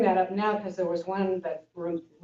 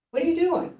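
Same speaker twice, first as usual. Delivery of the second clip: teacher asking; 11 semitones higher, faster than usual. Retold or imitated. Teacher questions monk in koan.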